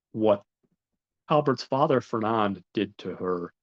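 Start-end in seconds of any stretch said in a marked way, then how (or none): none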